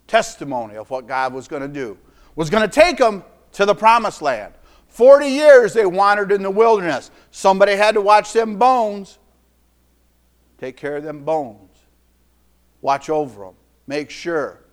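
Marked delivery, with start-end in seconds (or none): none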